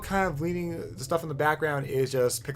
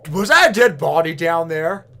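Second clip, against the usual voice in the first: startled; 5 semitones higher, 14 dB louder than usual. Real, not acted. Spots a body while diving.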